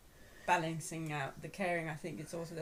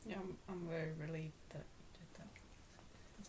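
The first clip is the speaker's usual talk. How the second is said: whispered aside; 8 dB quieter than usual. Real, not acted. Teasing aside.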